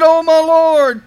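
loud, slow, drawn-out plea; a desperate, drawn-out appeal; pleading cry. Acted. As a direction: cry out to the Lord, begging. Moses pleading with God.

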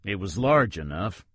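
plain reading voice

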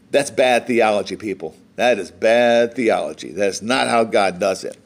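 low-pitched, sober rebuke; low, firm aside to the congregation; grave correction. Real, not acted. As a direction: drop the voice well down to correct them. Preacher soberly corrects Naomi's thinking.

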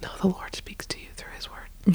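breathy whisper into the mic; Joe Biden whisper. Real, not acted. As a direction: whisper it right into the microphone. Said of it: "Joe Biden whisper".

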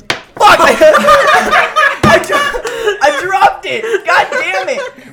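shocked yell, high and loud, laughing. Real, not acted. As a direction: blurt it out as something falls. Drops his lollipop, laughing.

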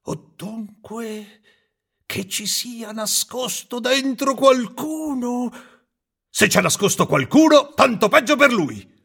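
high, shaky frightened carpenter; old carpenter scared, turning angry; trembling and stammering. Acted. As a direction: stammer at first, then work up to bluster. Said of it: "tremando e balbettando dallo spavento".

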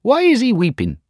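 high curious lizard; squeaky little creature asking. Acted. Little green lizard asks.

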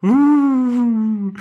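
mock plane whoosh, high; airplane noise. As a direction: hum like a toy plane. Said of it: "das ist jetzt mein Flugzeuggeräusch gewesen". (Flugzeuggeräusch, hoch)

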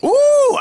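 loud, very high "ooh"; excited whoop. Real, not acted. Whooping after his own impersonation.

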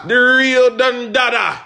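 slow, high hype shout. Acted. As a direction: bellow the name like a ring announcer. Host hyping guest.

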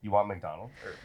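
asking mid-sip, drink at the lips; while sipping a drink. Real, not acted. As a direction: talk around a sip of kombucha. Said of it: "Sorry, I'm drinking my kombucha".

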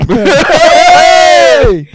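high, loud laughing whoop; laughing shout. Real, not acted. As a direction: burst out laughing and yelling.

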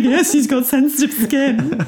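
slow, high-pitched insistent retort; retold protective reply, stretched out and amused; mock exasperation. Retelling her own exasperated reply.

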